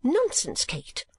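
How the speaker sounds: high, cross dismissal falling away; irritated mother cutting her off; angry. A narrator's reading. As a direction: snap at a daughter. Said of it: "said Missus Nickleby angrily".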